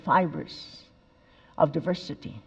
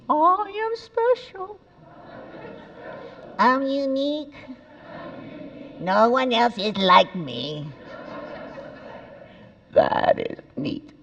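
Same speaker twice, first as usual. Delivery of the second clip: put-on audience echoing as children; 7 semitones higher, slower than usual. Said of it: "You are now children".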